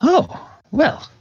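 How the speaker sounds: sudden high "oh well" of pleased surprise; a lightly voiced old mage's surprise; surprised old wizard. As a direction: pop up high, delighted. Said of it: "Adaluk says".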